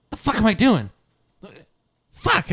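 high, loud startled cursing; startled outburst. Acted. Character looking up from digging at the commotion, startled outburst.

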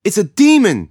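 a villager in the crowd yelling in panic; yelled. A narrator's reading. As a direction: scream it from the back of the crowd. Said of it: "someone yelled".

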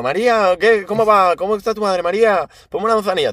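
high, overfamiliar call to the barmaid; hammed-up pushy customer ordering tea; mocking rude customer. Friend mocking a customer's high overfamiliar order.

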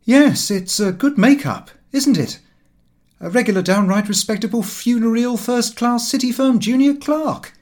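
sarcastic young clerk mocking his own respectable get-up; ironic. A narrator's reading. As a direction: show off the dull clothes with loud, lofty irony. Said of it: "He laughed rather ironically".

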